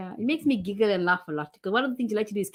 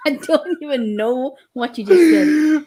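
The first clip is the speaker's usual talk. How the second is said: slow, louder words through tearful laughter; laughing; 5 semitones higher, 7 dB louder, slower than usual. Real, not acted. Wife laughing at her husband's joke, crying with laughter. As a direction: talk while laughing till you cry. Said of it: "You're so funny".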